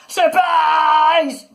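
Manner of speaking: loud, high, gleeful monster yell; spring it on them with a big shout; high, full-force yell. A narrator's reading. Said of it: "it yelled".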